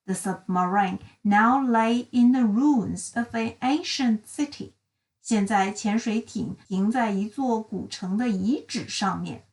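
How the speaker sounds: ordinary delivery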